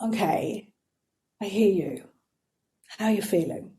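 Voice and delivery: very soft, calm, asking kindly; quiet, tender check-in with self-doubt; gentle therapist. Retold or imitated. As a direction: coax the fear out gently. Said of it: "gestalt therapy here".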